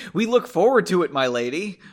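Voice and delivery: rushed, clumsy read of the line, too loud on forward; oddly stated. Shown as a demonstration. Stranger fawning over the lady, read awkwardly and rushed. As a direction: stumble through it awkwardly, a little fast. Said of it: "Sorry, that was really oddly stated".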